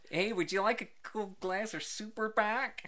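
mock American accent. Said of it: "it sounds too yank".

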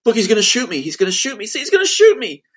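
high-pitched, loud manic yelling; manic screaming. Retold or imitated. Sister showing the clip to mom, manic screaming. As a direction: imitate a frantic, screaming accuser. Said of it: "screaming in a manic way".

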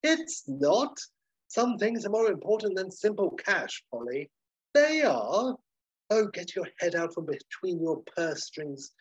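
high-pitched, theatrical lecturing; condescending hotelier. Acted. Basil lecturing Polly about money, high pompous condescension. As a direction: lecture her with grandiose condescension. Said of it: "Basil's like".

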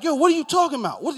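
fast, high, loud mock rant; shrill-pitched imitation of a furious man; mad. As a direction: yell it in exaggerated outrage. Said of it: "he was mad".

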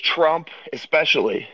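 normal talking voice

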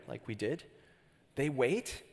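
usual speaking voice

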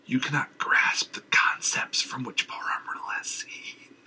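ominous whisper. Acted. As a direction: hiss it as a warning. Madman whispers.

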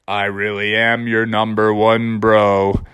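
low, slow, mopey donkey voice; gloomy Eeyore. Acted. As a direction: sigh it out like Eeyore. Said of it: "And Eeyore's like".

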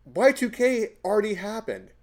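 high, loud retold exclamation; telling the brother he's clueless, loud and high; exasperated exclaim. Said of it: "I'm like".

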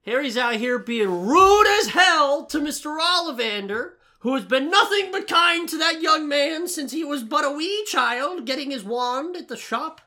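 high, loud indignant tirade; outraged rant. Real, not acted. Ranting to cohost about Harry, loud and outraged. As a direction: rail against Harry at full volume.

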